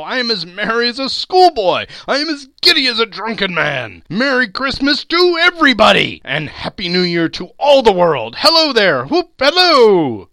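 high, loud, exuberant hollering; giddy jubilation. A narrator's reading. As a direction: build from glee to full-voice whooping. Scrooge giddy with joy, whooping.

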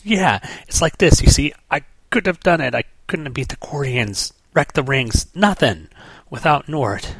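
a hint of a worked-up hothead owning up; Guy explaining. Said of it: "And he goes".

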